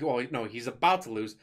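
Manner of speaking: ordinary delivery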